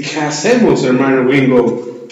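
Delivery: put-on joking American-accented Spanish; mock gringo accent. Gringo soldier asking his comrade for a plan, mock American accent. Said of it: "Es que así hablan en inglés los gringos".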